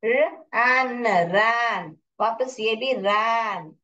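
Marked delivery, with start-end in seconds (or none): 0.0-2.0 "रन" and "रैन" as careful model words; phonics sounding-out. Shown as a demonstration. Teacher showing how to read by phonics, sounded out. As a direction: hold the sounds of "रैन" for learners. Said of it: "practice कीजिए फोनिक्स में".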